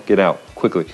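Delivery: usual speaking voice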